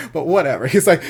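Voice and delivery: normal talking voice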